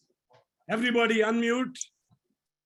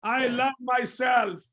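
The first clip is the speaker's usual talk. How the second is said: slow, raised chant for others to echo; mantra call; 5 semitones higher, slower than usual. Shown as a demonstration. Teacher giving the class a line to repeat, slow high call. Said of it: "repeat after me".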